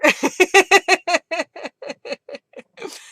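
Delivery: high cackling laughter; laughing fit. Real, not acted. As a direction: burst out laughing in a long run. Laughing fit starts up after sigh.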